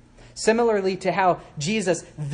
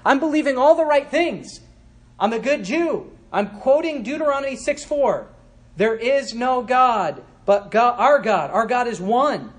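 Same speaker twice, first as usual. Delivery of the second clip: higher, louder proud believer; mock orthodox objector; 7 semitones higher, 5 dB louder than usual. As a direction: boast of your orthodoxy with a raised voice. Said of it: "an opponent might say this".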